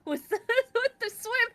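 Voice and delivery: laughing. Real, not acted. Giggling about the goofy swimming.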